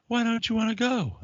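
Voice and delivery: hammed-up high wife voice; mock whiny wife. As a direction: imitate the wife pleading. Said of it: "And she was like".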